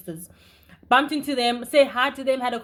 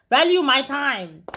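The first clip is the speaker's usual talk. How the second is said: slow and louder, each word punched; indignant, each word stressed; 3 semitones higher, 6 dB louder, slower than usual. Real, not acted. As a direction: spell it out slowly: value my time. Indignant declaration.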